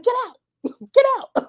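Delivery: high mock yell, breaking into laughter; hammed-up old lady shriek through laughs. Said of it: "you just be like".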